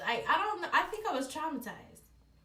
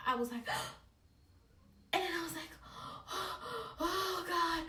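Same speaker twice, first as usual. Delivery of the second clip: sharp high gasps then a high "oh God"; 6 semitones higher, faster than usual. Retold or imitated. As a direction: gasp in shock and pain. Reenacting gasping in pain.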